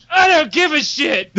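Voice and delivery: high-pitched dismissive yell; exasperated shout. Acted. Player whose throwaway character is being rescued, exasperated shout. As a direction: burst out that it doesn't matter. Acted indifference to the character's death.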